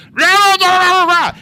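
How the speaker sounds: loud, high wordless mock rant; mock mom yelling. Mom yelling behind the shut door, mock rant. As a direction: imitate muffled yelling as noise. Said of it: "all I hear is the mom".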